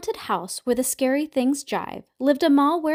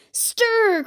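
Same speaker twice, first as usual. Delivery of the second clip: high, louder command; cried out; 11 semitones higher, 4 dB louder than usual. A narrator's reading. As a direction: call it like a mother giving orders. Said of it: "cried the ma".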